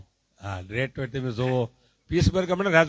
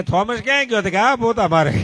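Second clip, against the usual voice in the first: laughing punchline; 8 semitones higher, 9 dB louder than usual. Retold or imitated.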